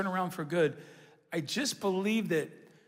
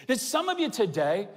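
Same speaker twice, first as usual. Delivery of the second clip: ordinary delivery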